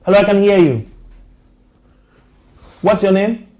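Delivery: loud, high phone voice; calling to caller. Real, not acted. Host asks an unheard caller's name, calling out. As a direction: raise your voice to reach a faint caller.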